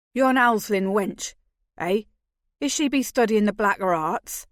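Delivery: put-on teasing North Country yokel accent. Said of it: "He croaked this out in a perfect North Country accent".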